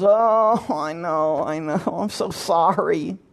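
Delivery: soft, drawn-out mock contrition; simpering apology. Neighbor apologizing over intercom for waking you, simpering. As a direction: ooze apology, starting up then dropping soft.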